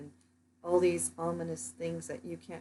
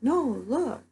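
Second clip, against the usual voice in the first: higher, louder retold call; higher louder mock call; 7 semitones higher, 7 dB louder than usual. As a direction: call the crowd to look up. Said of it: "yelled out to everyone".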